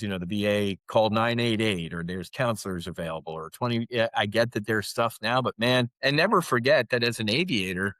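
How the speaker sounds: normal talking voice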